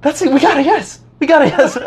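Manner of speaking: high voice building to loud glee; dawning excitement. Acted. Teller realizes in his head it's a yes, growing elated. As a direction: start high and build to a laughing cheer.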